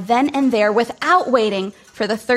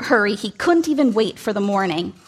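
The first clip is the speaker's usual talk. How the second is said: ordinary delivery, nothing marked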